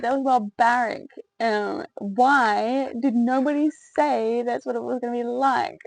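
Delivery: indignant complaint. Retold or imitated. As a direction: complain in shocked disbelief. Retold upset complaint.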